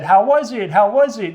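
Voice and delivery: high, loud mock-excited questioning; super excited. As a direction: imitate an over-eager, excited greeting. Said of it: "You were super excited".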